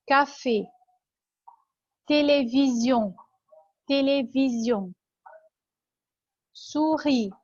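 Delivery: isolated French words like "كافي" and "سوري", é and ee kept distinct; dictation-style words with long pauses, "كافي" ending in é, "سوري" in ee; i versus é drill. Shown as a demonstration. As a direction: model "سيني" as see-nay, each syllable clear. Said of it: "هنفرق بين الاتنين في كلمات".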